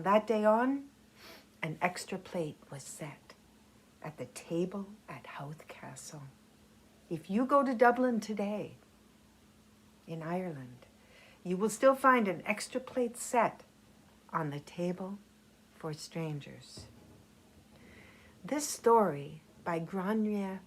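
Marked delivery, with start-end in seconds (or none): 1.6-6.2 fading to a soft whisper; hushed ending. A narrator's reading. Story's closing line. 14.3-16.9 hushed ending. A narrator's reading. Final moral of the story.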